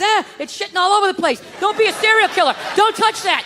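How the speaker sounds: put-on loud, high scolding at the kids; harried parent. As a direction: shout orders at unruly children.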